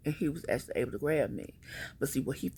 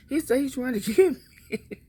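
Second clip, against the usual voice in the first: laughing through the words, higher and louder; 11 semitones higher, 7 dB louder than usual. Real, not acted. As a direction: crack up mid-sentence. Laughing while retelling her plea.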